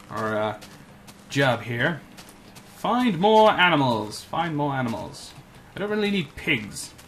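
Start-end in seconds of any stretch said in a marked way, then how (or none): 2.8-4.2 higher, louder and slow declaration; emphatic. Real, not acted. Player declaring the next task, emphatic. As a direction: announce the new mission. Repeats goal louder first.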